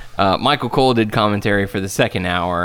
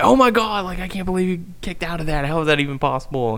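excited commentator; 7 semitones higher, faster than usual. Retold or imitated. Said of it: "adding to some of the commentary, like".

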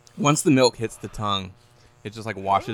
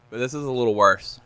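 ordinary talk

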